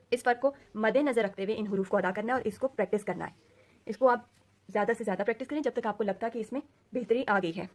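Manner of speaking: ordinary talk, nothing marked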